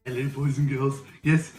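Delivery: ordinary talk, nothing marked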